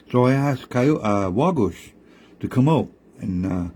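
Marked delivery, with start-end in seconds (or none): none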